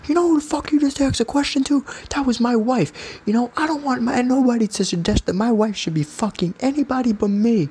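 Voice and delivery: mock the giant husband's outrage, pitch way up; imitating an angry guy. Huge husband confronting the podcaster, hammed-up high mock anger. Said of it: "He goes".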